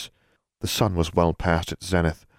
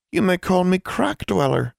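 tiny elf; 13 semitones higher than usual. Acted. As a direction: speak like a small wrinkled creature. Small creature speaks.

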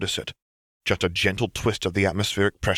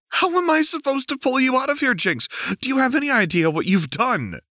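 exasperated Lux; 13 semitones higher than usual. A narrator's reading. Lux scolding captive Jinx, higher and exasperated. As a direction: throw up your hands at her.

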